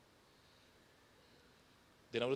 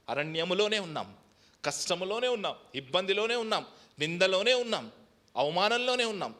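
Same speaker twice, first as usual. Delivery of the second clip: from steady to louder and higher; preaching at full force, building; 6 semitones higher, 6 dB louder than usual. Acted. Pastor listing the hardships to the church, building fiery preaching.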